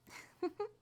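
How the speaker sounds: soft, high little laugh; small high-pitched chuckle; coy giggle. Acted. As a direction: giggle shyly and briefly. Coy laugh about her services.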